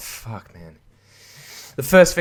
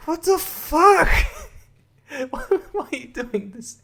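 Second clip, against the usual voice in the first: baffled disbelief. Acted. Acted bewildered reaction to beeping robot.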